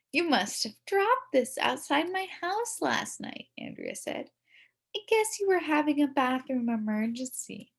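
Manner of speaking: high, sugary gotcha taunt; girl mocking a boy about toilet paper; smug know-it-all girl. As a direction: sweetly rub it in.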